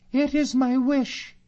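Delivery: very high, loud female character voice; Katrina's voice. Acted. Katrina answers Allan about breaking the seal, very high woman's voice. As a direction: pitch it far up as Katrina.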